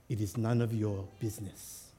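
low, quiet, stone-cold refusal; icy and measured, staring straight ahead. Acted. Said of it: "goes stone cold".

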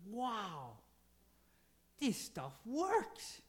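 high, slow amazed wonder; amazed wow. Pretend. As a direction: marvel at it, stretching the words out. Acted amazement at scripture working.

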